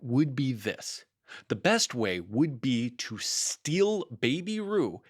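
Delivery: slow, drawn-out plotting; mildly conspiratorial Rabbit; scheming. Acted. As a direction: lay out the plan slowly and slyly. Rabbit unveiling his plan.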